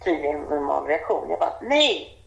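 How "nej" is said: high, sudden mock shout of alarm; retold shout, pitched up; retold scream. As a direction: cry it out as an urgent stop, imitating. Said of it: "då bara skrek jag".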